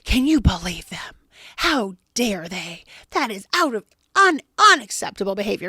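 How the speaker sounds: acted-out outraged complaint; indignant snapping. Upset person complains to an unbothered companion, snapping indignantly. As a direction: snap in mock outrage at someone's behavior. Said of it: "you're the one snapping like that".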